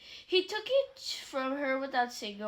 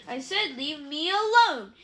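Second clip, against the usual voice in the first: loud, slow furious shout; 8 dB louder than usual. A narrator's reading. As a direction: yell it to drive him off. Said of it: "Harry shouted".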